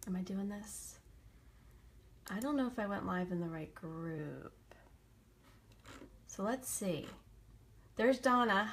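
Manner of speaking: usual speaking voice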